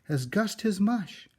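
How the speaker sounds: shocked neighbours cluck about the crazy man; scandalised neighbours. Acted. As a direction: neighbours scandalised by the painted house, high and loud. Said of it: "the people said".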